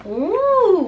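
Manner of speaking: ordinary delivery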